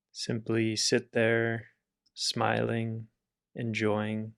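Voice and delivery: calm, level meditation voice with long pauses; guided meditation. Acted. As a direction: guide them gently through relaxing the body. Leads a body relaxation.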